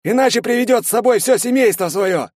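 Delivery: high, loud urgent shout; крик. A narrator's reading. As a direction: yell a warning to the squad. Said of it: "закричал Карасик". (тревожный крик командира)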